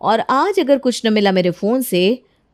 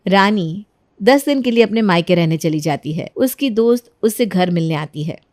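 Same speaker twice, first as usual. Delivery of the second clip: calm, lower storyteller voice explaining the plot; story narration; 6 semitones lower than usual. A narrator's reading. Narrator explains Rani's trick to the audience, in a lower, even storytelling voice. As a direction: narrate it evenly, like telling a tale.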